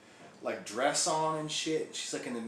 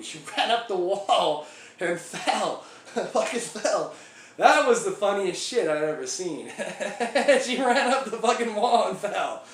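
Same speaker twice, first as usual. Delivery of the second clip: loud, laughing through the words, pitched higher; 5 semitones higher, 7 dB louder than usual. Real, not acted. Retelling his girlfriend falling off the wall, laughing out loud. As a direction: crack up while telling it.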